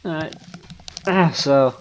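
normal talking voice

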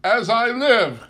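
crying out. A narrator's reading. Nephew crying out to his guests about his uncle. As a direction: cry it out in merry disbelief. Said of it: "cried Scrooge's nephew".

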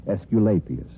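ordinary talk, nothing marked

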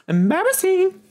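high squealed cry of embarrassment; exaggerated shout. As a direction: shriek it in mock horror. Laughing at own mic blunder.

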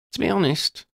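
put-on girl's voice, amused then serious; Lydia, wry schoolgirl. A narrator's reading. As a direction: tease her friend, then own up frankly. Lydia continues.

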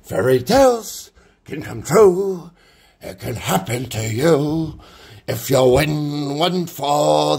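lightly voiced crooning impression, slow in places; imitating a character. Shows a potato head figure, lightly crooning. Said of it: "talks like that guy".

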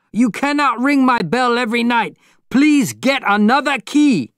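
loud, high-pitched complaint; furious high-pitched yelling; angry shout. A narrator's reading. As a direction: scold an annoying neighbour at the top of your voice. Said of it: "Mister Yuanashi shouted".

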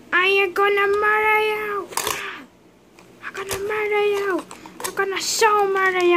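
childlike pretend menace; mock threat. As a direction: ham up the murder threat. Villain figure threatens.

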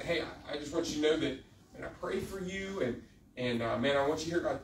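soft, low, halting, tender address; gentle reassurance. Retold or imitated. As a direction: speak softly, as if counseling a teenager. Retold gentle talk to teenager.

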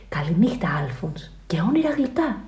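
soft whisper; whispered goodnight. A narrator's reading. Father saying goodnight from the door, whispering.

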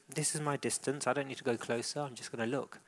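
soft, settled inner voice of the dog; calm dog voice. Pretend. Trainer voices the calm dog deciding its distance, soft and settled. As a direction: say it quietly like a content dog. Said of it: "and she goes".

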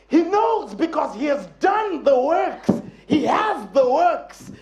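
ordinary delivery

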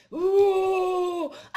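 loud drawn-out "uh", then a scream; wolf howl and scream. Acted. Wolf bursting in on frightened grandma, howl and scream. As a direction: pounce with a howl, answer with a shriek.

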